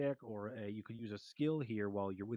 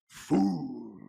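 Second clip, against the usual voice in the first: a loud bestial roar of a word, higher and louder; growling dragon voice; 5 semitones higher, 9 dB louder than usual. Acted. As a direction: spit it out like an enraged dragon. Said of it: "in its draconic voice".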